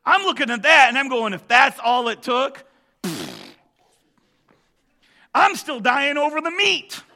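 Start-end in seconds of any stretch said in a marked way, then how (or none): 1.3-7.0 loud, laughing self-mockery; joking exclamation. Real, not acted. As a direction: laugh at yourself over the meat. Preacher's own joking inner voice.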